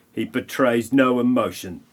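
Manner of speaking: ordinary talk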